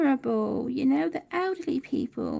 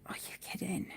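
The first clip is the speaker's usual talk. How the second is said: lower, hushed whisper of disbelief; 7 semitones lower, 12 dB quieter than usual. Retold or imitated. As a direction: mouth it in quiet disbelief. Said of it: "I was just like".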